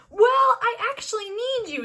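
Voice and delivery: playing the Jedi master, high and slow; Sol's voice. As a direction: pitch it up and draw it out. Sol voiced in retelling.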